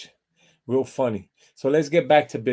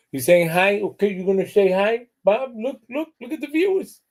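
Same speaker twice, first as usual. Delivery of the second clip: high coaxing pet voice, rising; sweet talk to a cat, much higher; 7 semitones higher than usual. Real, not acted. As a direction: coo at the cat to turn around.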